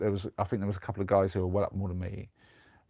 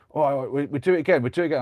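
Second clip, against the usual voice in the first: worked-up retold demand, higher and louder; raised voice, mock fuming insistence; imitating fuming gambler; 5 semitones higher, 7 dB louder, faster than usual. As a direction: act out a mate losing his temper. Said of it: "he's, like, getting fuming".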